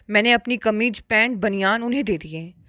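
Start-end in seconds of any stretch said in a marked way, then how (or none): none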